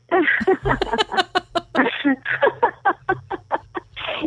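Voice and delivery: a high, open burst of laughter; hearty laugh. Real, not acted. Host laughing about humming the Tennessee Waltz for weeks, high hearty laugh. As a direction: let a real laugh ring out high.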